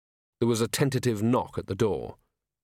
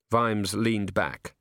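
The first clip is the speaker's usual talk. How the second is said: usual speaking voice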